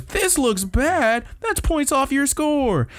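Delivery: very high, slow, put-on tiny character; mock kid voice. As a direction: drag it out in a high falsetto scolding. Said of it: "tiny and dressed like a kid".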